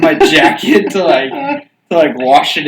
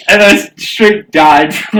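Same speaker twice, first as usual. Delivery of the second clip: usual speaking voice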